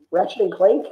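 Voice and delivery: ordinary delivery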